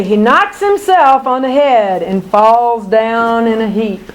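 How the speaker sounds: usual speaking voice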